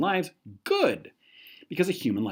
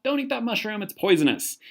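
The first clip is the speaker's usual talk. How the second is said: mock yell; 10 semitones higher than usual. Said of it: "your friend yells out".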